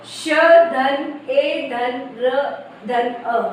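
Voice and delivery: very slow, each letter spelled apart; drawn-out phonics breakdown; slow letter-by-letter spelling. Shown as a demonstration. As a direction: spell it out slowly for children. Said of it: "ये मतलब ऐसे बना कि".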